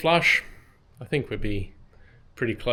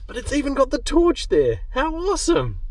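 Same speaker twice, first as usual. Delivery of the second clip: high thrilled delight with a laugh; excited; 13 semitones higher, 3 dB louder than usual. Real, not acted. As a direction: gush over the find, laughing. Delighted find in the glove box.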